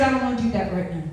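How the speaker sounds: quick refusal. Retold or imitated. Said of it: "I said".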